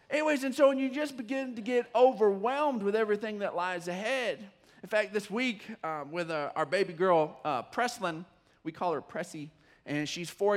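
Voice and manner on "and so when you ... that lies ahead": usual speaking voice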